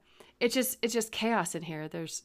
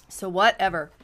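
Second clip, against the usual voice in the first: louder whatever; 6 dB louder than usual. Real, not acted.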